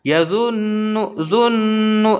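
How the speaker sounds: यजुन्नु, जुन्नु with the न hummed long; slow demonstration, न sustained in यजुन्नु; ghunna nasal hold. Shown as a demonstration. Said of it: "आवाज़ अपना नाक में रोकेंगे".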